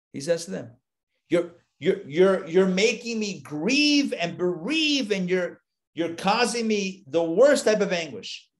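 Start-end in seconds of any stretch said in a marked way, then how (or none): 1.3-8.5 put-on higher, slow, pained old father lamenting; anguished father Jacob. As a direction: draw out a grieving father's complaint. Grieving father Jacob speaks.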